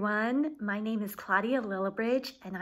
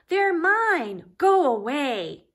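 higher, louder grumpy snap; grumpy grumble; 5 semitones higher, 7 dB louder, slower than usual. Acted. Pig shooing Trevor from his toys, grumpy and loud. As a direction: grumble like a spoiled, greedy pug. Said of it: "But Pig would just grumble".

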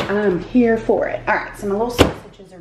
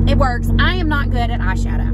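ordinary talk, nothing marked

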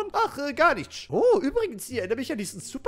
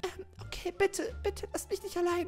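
higher, softer begging; soft pleading princess; 9 semitones higher, 6 dB quieter than usual. Acted. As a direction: beg not to be left alone. Prinzessin fleht leise. (leise, hohe, flehende Prinzessinnenstimme)

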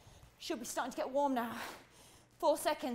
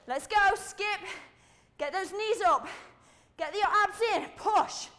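raised, forceful commands; driving coach shout; 5 semitones higher, 4 dB louder than usual. Real, not acted. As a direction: push them to work harder. Coach driving the skipping set.

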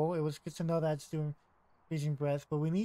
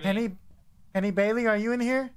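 calling out; 4 semitones higher, 5 dB louder than usual. Real, not acted. Calling out to the ghost.

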